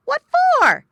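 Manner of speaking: high, loud shouted question; called back question. A narrator's reading. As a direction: yell back a question across the water. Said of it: "asked Little Joe".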